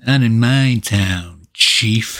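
slow, drawn-out mock action quip; mock one-liner. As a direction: deliver it like a cheesy movie one-liner. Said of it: "hate those one-liners".

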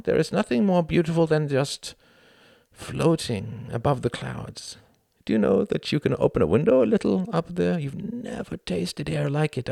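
a hint of a proud young German; lightly voiced Klaus. A narrator's reading. Klaus confiding in Tom on the walk, earnest.

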